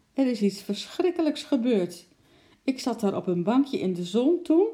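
a lady in distress calls out; unhappy lady. Acted. Upset lady warns the zookeeper, lightly voiced. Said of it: "Haar gezicht stond ongelukkig".

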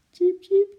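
high, loud little chirps; animal peeping. A narrator's reading. Eki answering Lotje, high squeaky peeps. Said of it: "piepte Eki".

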